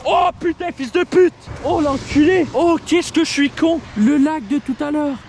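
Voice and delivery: high, loud self-annoyed swearing; real exasperated outburst; frustrated cursing. Real, not acted. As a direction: curse yourself out loud in frustration. Realises wet feet again.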